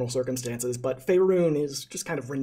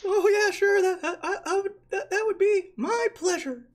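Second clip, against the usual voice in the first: a tiny kobold whining at the stench; squeaky kobold. Acted. DM voices the kobold.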